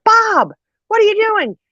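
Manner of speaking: very high-pitched mock-mom outcry; alarmed protest. Mom to dad driving off without dog, alarmed protest. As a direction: imitate a mother exclaiming in alarm.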